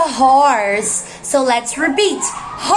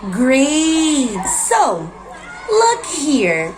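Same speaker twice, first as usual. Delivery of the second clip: ordinary delivery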